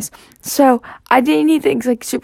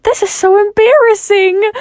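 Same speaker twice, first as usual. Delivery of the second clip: much higher, louder embarrassed wail; high embarrassed whine; 12 semitones higher, 5 dB louder than usual. Retold or imitated. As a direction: squeal it out, mortified. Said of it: "I was like".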